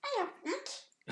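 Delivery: very high, slow attempt at a regional accent; squeaky, drawn-out mock accent; Owen's imagined accent. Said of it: "in the accent that you believe Owen's have".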